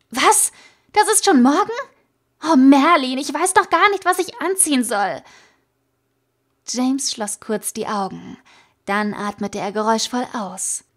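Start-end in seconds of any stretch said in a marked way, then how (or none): 0.1-5.2 hoch und laut, aufgeregt herausplatzend; panisch überrascht. A narrator's reading. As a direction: erschrockene Panik, die sich überschlägt. Plötzliche Panik wegen des Ausflugs. (aufgeregt zu James, hoch und laut panisch)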